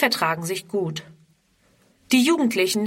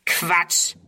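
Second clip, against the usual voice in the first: scornful; 3 dB louder than usual. A narrator's reading. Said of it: "höhnt ein dritter".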